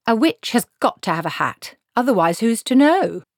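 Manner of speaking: insist, it's obvious; insistent old witch. Acted. Granny lecturing Cutangle about witches' hats, insistent.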